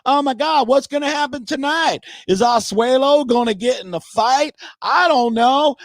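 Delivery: high, gushing mock girly voice; mock gossiping girlfriend. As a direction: ham up a gossiping girlfriend hooked on reality TV. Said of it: "Go talk to your girlfriends".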